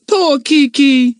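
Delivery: high, loud, slow scared squawk; frightened parrot. A narrator's reading. Kiki the parrot, frightened by the voices. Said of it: "said the parrot in a fright".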